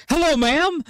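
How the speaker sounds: very high, eager greeting; hammed-up enthusiastic hello; imitating excited caller. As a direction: blurt it out thrilled, way up high. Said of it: "they were, like, really".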